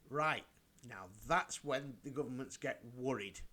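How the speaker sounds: ordinary talk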